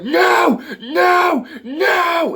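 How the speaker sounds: high, loud, drawn-out wailing shouts; mock rampage yell. Tallahassee rampages over hippy dippy people, mock wailing shout. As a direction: wail each word in hammed-up outrage. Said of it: "like, this rampage".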